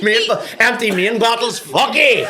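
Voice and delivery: high, loud, laughing swipe; mock outrage dissolving in laughter; laughing mock rant. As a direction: spit it out through laughter. Mock angry retelling, laughing.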